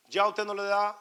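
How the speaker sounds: ordinary talk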